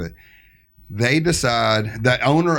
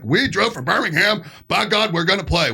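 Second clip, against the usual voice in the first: mock gung-ho band; 8 semitones higher than usual. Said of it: "a lot of bands would be like".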